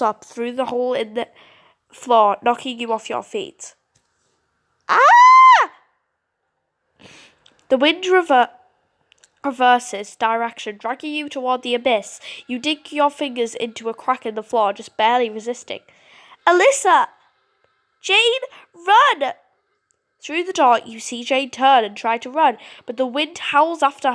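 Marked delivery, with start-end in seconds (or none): 4.9-5.7 sudden high loud cry; startled shout. Acted. Child knocked off her feet by the wind, high sudden yelp. 16.5-19.3 high loud warning cry; urgent shout. Acted. Girls calling to each other as the wind attacks, loud and high.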